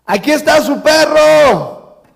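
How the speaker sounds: high, loud, long-drawn holler; neighbour calling out. Retold or imitated. Neighbour on a rooftop to family below, hammed-up shout. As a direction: imitate yelling down from far above. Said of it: "sale una vecina del techo".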